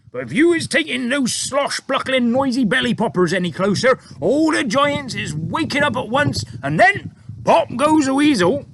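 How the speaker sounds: booming high giant voice, loud; BFG giant voice. A narrator's reading. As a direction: giant warning the girl to hold tight, loud giant voice. BFG voiced in read story.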